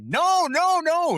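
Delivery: high, loud, frantic no; panicked mock protest; Ross panicking. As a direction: stop her in a panic. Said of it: "Ross immediately".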